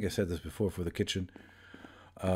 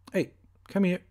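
calling out; 10 semitones higher, 4 dB louder than usual. Acted. Coaxing a game character over.

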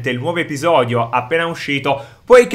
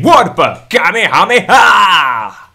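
move-name shout; 3 semitones higher, 6 dB louder, much slower than usual. Real, not acted.

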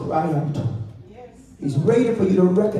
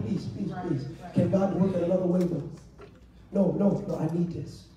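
acted pleading. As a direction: act out begging to keep it, swelling and shrinking. Acts out someone clinging to hurt.

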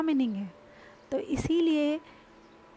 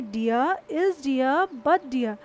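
dear but dear, each word long and drawn out; very slow example; much slower than usual. Shown as a demonstration. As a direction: drag the words out for the class. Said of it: "sentence में ये क्या बोल रहा है". (बहुत धीमे खींचकर)

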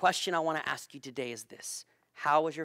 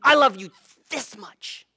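father answers; 8 semitones higher, 10 dB louder than usual. Retold or imitated. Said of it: "And he'd say".

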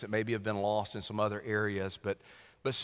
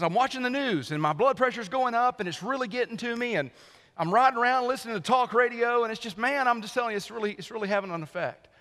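higher and louder, all wound up; worked-up friend; 11 semitones higher, 4 dB louder than usual. Retold or imitated. Friend phones the pastor about his blood pressure, worked up and higher. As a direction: play the friend boiling over about talk radio. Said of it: "so, so he says".

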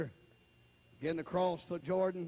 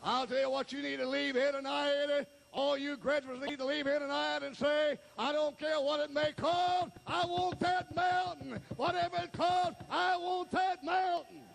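high, rousing pulpit delivery; rallying revival preacher; full-force preaching; 9 semitones higher than usual. Real, not acted. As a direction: build to a ringing high cry. Rallies graduates at full force.

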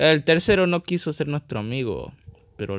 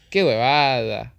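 loud annoyed outburst; 7 dB louder, slower than usual. Real, not acted. Annoyed after losing.

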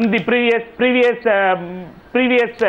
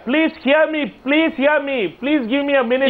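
higher-pitched, urgent appeal; insistent pleading; 4 semitones higher than usual. Real, not acted. Panellist begging to be heard over interruptions, insistent. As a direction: plead for the floor, repeating yourself.

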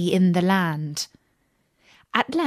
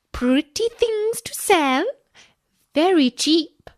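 peddler's cry; 8 semitones higher than usual. A narrator's reading. Disguised queen calling at the dwarfs' door, high peddler cry. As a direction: call out wares like an old hag. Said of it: "and cried".

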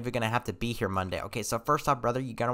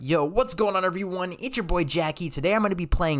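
YouTuber intro voice; 8 semitones higher, 4 dB louder than usual. Acted.

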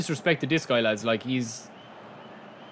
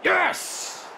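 excited cheer; much slower than usual. Real, not acted. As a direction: cheer like you just scored. Celebrating a goal.

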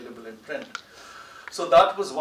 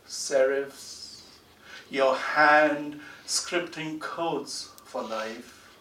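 slow, measured recitation with long pauses; poem reading; 3 dB louder, slower than usual. Acted. Poet reading his tribute to Kaifi Saab, slow and measured. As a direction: read the poem slowly, letting each line land.

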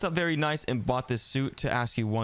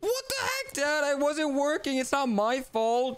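much higher, whining defence; whiny protest; 11 semitones higher, slower than usual. Acted. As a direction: plead your innocence like a sulky kid. Son protests to dad.

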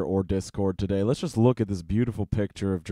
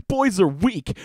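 mock outrage; 7 semitones higher, 3 dB louder than usual. Real, not acted. Outraged reaction to post.

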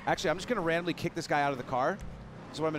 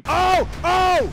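loud high startled yells; real shock, shout it out high; surprised shout; 13 dB louder than usual. Real, not acted. Gamer shocked as his car launches, shouting.

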